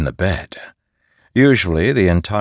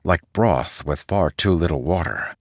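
usual speaking voice

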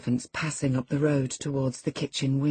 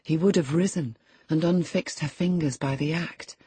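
usual speaking voice

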